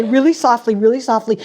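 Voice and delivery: ordinary talk, nothing marked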